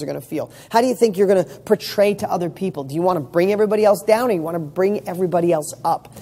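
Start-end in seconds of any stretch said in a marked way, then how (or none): none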